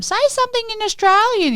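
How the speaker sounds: very high-pitched mock voice; squeaky-high imitation of a nagging request; mimicked pestering request. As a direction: mimic an eager customer, way up high. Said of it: "so people say to".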